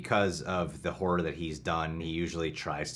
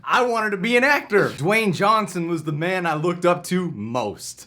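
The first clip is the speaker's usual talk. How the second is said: higher, bossy security guard; 11 semitones higher, 8 dB louder than usual. Acted. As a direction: wave the line through like a bored guard. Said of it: "I'll play Alfred".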